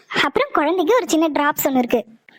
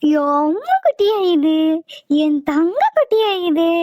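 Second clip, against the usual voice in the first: put-on higher, slow sing-song cooing to a baby; doting baby talk; 3 semitones higher, slower than usual. Mother fondly cooing to her newborn, slow high baby talk. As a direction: coo over the little one like a doting mother.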